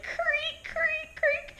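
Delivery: put-on high, thin squeaks like old floorboards; imitated floor creaks. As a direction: creak it out, high-pitched and repeated. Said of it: "all the creaks of the floor".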